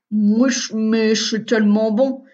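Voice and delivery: talking through a mouthful of egg; words round a bite of food; mouth full. A narrator's reading. Augustin answers his mother while chewing, mouth full. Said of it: "la bouche pleine".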